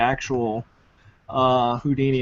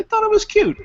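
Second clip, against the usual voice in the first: much higher, sing-song cute voice; squeaky cute delivery; cutesy high voice; 4 dB louder than usual. Real, not acted. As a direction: coo it like something adorable. Cute remark, pitched way up.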